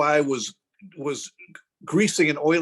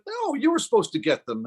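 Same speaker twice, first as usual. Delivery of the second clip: higher-pitched mock scolding wife; indignant retort; 8 semitones higher than usual. Wife answering tattooist's call, mock-indignant retort. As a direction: throw it back at him, pitched up. Wife's retort in acted exchange.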